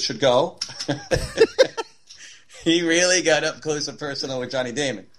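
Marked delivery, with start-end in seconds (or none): 0.6-1.8 high laugh. Real, not acted. Reacting to a funny demonstration.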